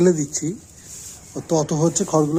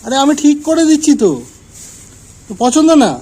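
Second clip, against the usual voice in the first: louder, higher, fed-up scold; exasperated scolding; 7 semitones higher, 7 dB louder than usual. Real, not acted. Telling off the pet that rejects her bedding, loud exasperated scold. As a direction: raise your voice at a stubborn pet.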